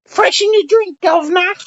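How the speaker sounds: very high-pitched English caricature; mock English accent. Mocking the English about their accents, in a high squeaky English accent. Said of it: "did you ever hear some of your accents?".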